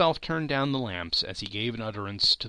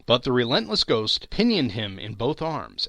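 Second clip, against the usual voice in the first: usual speaking voice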